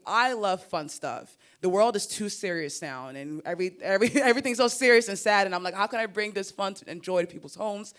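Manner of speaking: ordinary talk, nothing marked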